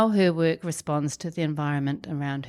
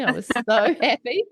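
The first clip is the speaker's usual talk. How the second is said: laughing; 7 semitones higher than usual. Real, not acted. Retelling joyful reply.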